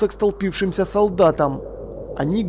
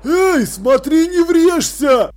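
warning shout; 10 semitones higher, 5 dB louder, much slower than usual. A narrator's reading. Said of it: "начал было сержант".

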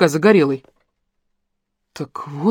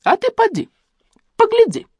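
put-on high, crooning old woman's voice, much higher; coaxing granny. A narrator's reading. Бабуля уговаривает городского пойти посмотреть, высоким старушечьим голосом. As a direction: sweetly urge him to go look. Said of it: "посоветовала бабуля".

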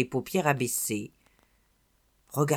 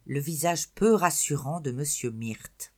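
usual speaking voice

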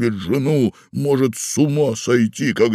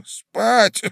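put-on high, slow old man rambling about a doll; doting old man; 14 semitones higher, 4 dB louder than usual. A narrator's reading. Old husband babbles to his wife about a doll.

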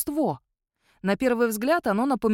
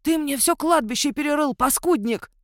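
high, angry scolding; indignant outburst; 7 semitones higher, 4 dB louder than usual. A narrator's reading. Watchman scolds werewolf for digging up cemetery, indignant. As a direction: let rip at the culprit, pitched right up. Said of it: "возмутился Ионуц".

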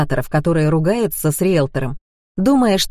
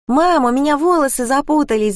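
put-on high-pitched child's call to mum; little girl's mild wail for help; Anya crying out; 9 semitones higher than usual. A narrator's reading. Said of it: "закричала Аня".

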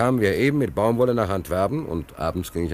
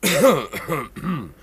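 staged cough; 8 semitones higher than usual. Acted. Sailor coughing to get the lady's attention, a staged cough.

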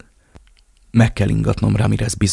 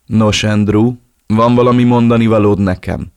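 menacing taunt; 6 dB louder than usual. Acted. Villain taunts tortured captive.

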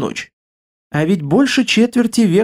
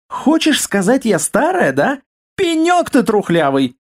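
higher-pitched, indignant challenge; angry wife; 6 semitones higher than usual. A narrator's reading. Wife offended at being called old, indignant. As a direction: take offence and throw it back at him. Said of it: "в тёмных глазах блеснули искры гнева".

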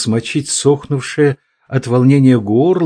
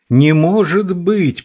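breathless, higher desperate cry; urgent panting report; 6 semitones higher, slower than usual. A narrator's reading. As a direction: gasp it out, nearly choking. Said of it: "почти задыхаясь, крикнула Ия".

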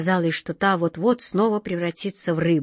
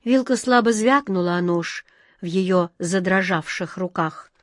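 ordinary delivery

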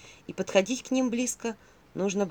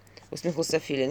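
plain reading voice

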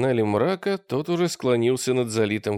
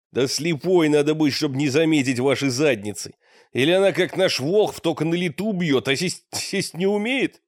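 scornful, raised rough voice; disgusted; 4 semitones higher than usual. A narrator's reading. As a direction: sneer it out in disgust. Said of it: "сказал брезгливо".

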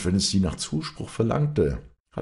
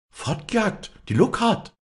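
high, shocked outcry, louder at the name; shocked cry; 5 dB louder than usual. A narrator's reading. Walli crying out on hearing her friend was chased away, shocked and much higher. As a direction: cry out in disbelief. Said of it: "schrie Walli auf".

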